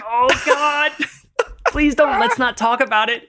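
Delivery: high squealing plea broken by laughter; cringing through a laugh, begging him not to; laughing mock plea. To a friend, recalling dreading the doctor's question: laughing plea. Retelling her own panicked plea.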